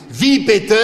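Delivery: high, loud outraged brother; the middle brother protests the point, outraged; indignant cry. Acted. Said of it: "rief mein mittlerer Bruder entrüstet".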